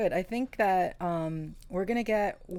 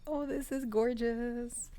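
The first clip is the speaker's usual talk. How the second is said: higher, drawn-out gush; swooning heart voice; 6 semitones higher, 3 dB quieter, slower than usual. Acted. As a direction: voice your heart melting with delight, slowly. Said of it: "My heart chakra is like".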